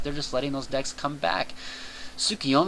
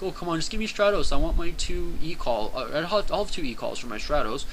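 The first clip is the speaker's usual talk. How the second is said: higher-pitched mock whine, settling at the end; mock whining; 5 semitones higher than usual. Imitating players begging for Stratos back, mock whining. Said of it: "people are gonna really whine".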